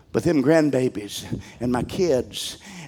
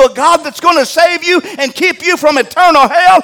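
higher and louder, urging the flock; preaching at full force; 12 semitones higher, 8 dB louder than usual. Acted. Pastor urges parents to get to church, preaching hard. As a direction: lean into it like a revival preacher.

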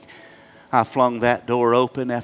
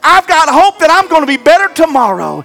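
higher, louder, driving toward hope; rising preaching; 10 dB louder than usual. Acted. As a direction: lift the room with each line. Builds to full preaching.

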